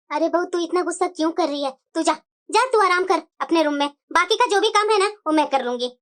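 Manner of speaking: put-on a weary old lady sighing at a quarrel.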